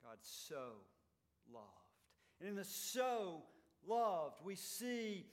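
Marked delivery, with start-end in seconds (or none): 0.0-1.7 SO set apart by a pause before "loved"; low, deliberate, pausing after "so"; emphatic. Shown as a demonstration. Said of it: "emphasis of the intensity of God's love". 2.6-4.4 SO and LOVED both hit loud; loud, separate stresses on "so" and "loved"; intense emphasis. Shown as a demonstration. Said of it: "emphasis of the intensity of God's love".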